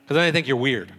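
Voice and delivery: ordinary delivery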